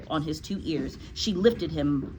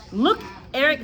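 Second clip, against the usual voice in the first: schoolboy voice; 8 semitones higher, 4 dB louder than usual. Acted. Eric answers in boy voice.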